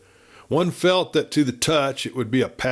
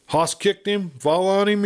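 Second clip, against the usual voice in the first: higher, urgent questions about the injured brother; voiced big man, higher and pressing; anxious questions; 9 semitones higher than usual. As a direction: ask it quickly, pitch up with worry.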